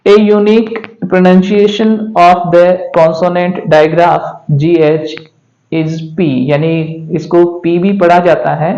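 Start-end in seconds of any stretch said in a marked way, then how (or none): 0.1-6.3 slow reading. Shown as a demonstration. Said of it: "इसका unique pronunciation है".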